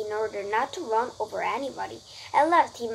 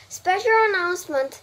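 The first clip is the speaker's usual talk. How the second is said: higher, louder announcer voice; pompous loudspeaker; 8 semitones higher, 7 dB louder than usual. A narrator's reading. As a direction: announce it with pomp. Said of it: "said the loudspeaker in a pompous voice".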